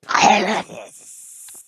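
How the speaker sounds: very high, loud, slow strained rasp; golem. Acted. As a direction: croak it out like Gollum. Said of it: "Golem voice works to get your sinuses clear".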